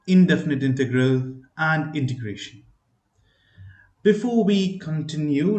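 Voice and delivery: ordinary delivery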